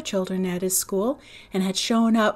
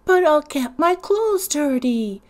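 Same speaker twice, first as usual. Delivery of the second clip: put-on slow, higher little-boy protest; 6 semitones higher, 5 dB louder than usual. Little man refusing to climb the bank, slow childish protest. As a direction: plead like a fussy small child. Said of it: "protested little man".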